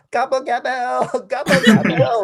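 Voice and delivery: very high, drawn-out campy gobble; slow, high-pitched camp turkey. Acted.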